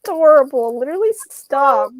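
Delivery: loud delighted squeal of a reply; excited gush. Real, not acted. Friend reacting to the bunny story, loud excited gush. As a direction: burst out with delight.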